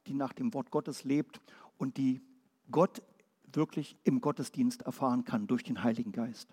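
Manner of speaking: ordinary delivery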